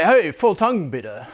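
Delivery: playful, upbeat hello; cheery mock greeting in German; friendly greeting. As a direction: hail a friend with it. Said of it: "we'd greet each other and say".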